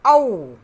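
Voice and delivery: औ called out loud, round open au; औ, loud teaching pronunciation; clear vowel demo. Shown as a demonstration. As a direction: model the sound औ loudly for children. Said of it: "और उसका उच्चारण होगा".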